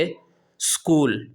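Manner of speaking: اسکول said slowly as skool; اسکول carefully pronounced as skool; correct skool, slowly. Shown as a demonstration. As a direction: stretch out skool, starting on the s. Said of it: "بلکہ یہ لفظ ہے".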